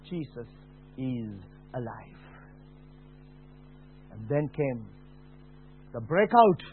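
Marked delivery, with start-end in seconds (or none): 0.1-2.2 soft and slow, very hushed; tender whisper-like reassurance; quiet beautiful voice. Retold or imitated. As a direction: let it fall gently and slowly. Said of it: "the quiet voice, beautiful voice said".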